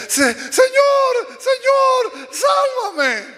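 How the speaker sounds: very high, loud, drawn-out cry; desperate scream. Acted. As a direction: scream to the Lord for rescue. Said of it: "volvió a gritar otra vez". (grito desesperado muy agudo)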